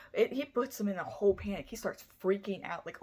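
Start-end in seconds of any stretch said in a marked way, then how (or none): none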